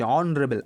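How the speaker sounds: অনারেবল starting on an open vowel, no H; অনারেবল said with a vowel onset; silent H. Shown as a demonstration. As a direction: drop the H in অনারেবল. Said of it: "এইচের উচ্চারণটা হচ্ছে না".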